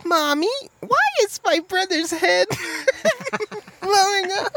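put-on high laughing little girl; squeaky child, cracking up; Drew Barrymore. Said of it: "That was my best Drew Barrymore".

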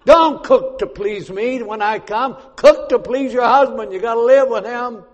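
preacher raising his voice to the women; preaching loud. Real, not acted. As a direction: call it out to the congregation. Preaching at full force.

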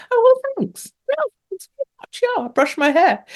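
very high, coy little voice for the thanks; mock squeaky thanks. Host answers a listener's compliment in a very high coy voice. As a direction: squeak it out like a flattered girl.

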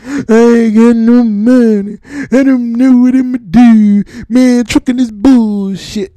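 high, slow, loud whine of a broke trucker; mock whining trucker. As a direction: whine about having no money. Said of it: "Watch how many of 'em be crying".

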